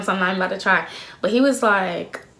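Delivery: usual speaking voice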